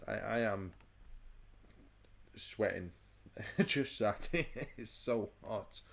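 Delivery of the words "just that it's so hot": laughing. Real, not acted. Laughing about the heat.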